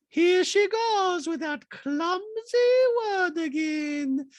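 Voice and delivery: slow, high falsetto; hammed-up drawn-out mock complaint; Diana impression voice. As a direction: stretch it out in a high put-on voice.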